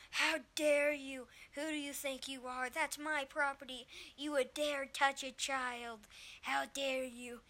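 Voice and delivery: entitled outrage. Retold or imitated. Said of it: "acting like he owned everybody".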